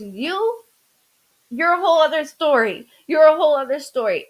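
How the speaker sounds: loud retort. Retold or imitated. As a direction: throw the line back loudly. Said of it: "He goes".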